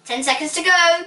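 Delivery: high, loud time warning; announcing. A narrator's reading. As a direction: call out the last seconds. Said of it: "heard Tony Truffle announce".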